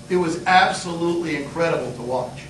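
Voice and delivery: ordinary talk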